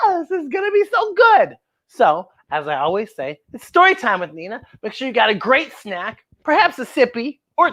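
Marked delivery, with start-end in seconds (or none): 0.0-1.6 much higher excited exclaim. Real, not acted. Storyteller thrilled to start the story for the kids. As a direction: burst with excitement about the book.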